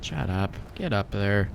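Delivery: usual speaking voice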